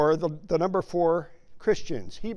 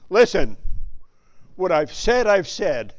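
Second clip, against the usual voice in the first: stern parent; 8 dB louder than usual. Retold or imitated. Parent scolding child, voiced.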